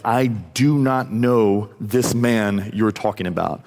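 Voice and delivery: slow, word-by-word denial; emphatic denial. Retold or imitated. Peter denying Jesus to other people, slow and emphatic. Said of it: "But now he's, like, talking to other people".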